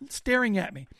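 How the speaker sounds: usual speaking voice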